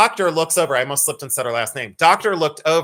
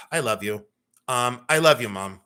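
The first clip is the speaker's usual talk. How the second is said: tender, touched; 5 semitones lower than usual. Real, not acted. Son thanks mom for her message, tender.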